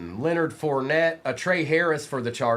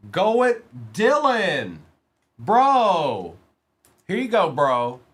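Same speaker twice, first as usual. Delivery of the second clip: louder and higher, celebrating the hit; hype shout; 4 semitones higher, 5 dB louder, slower than usual. Real, not acted. Host hyping Dylan's autograph hit, loud excited shout. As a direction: shout it up for the viewer's big pull.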